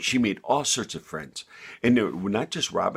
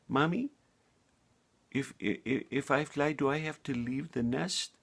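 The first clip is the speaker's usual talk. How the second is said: worried little bird; 6 semitones higher than usual. A narrator's reading. Sally asks mother worried.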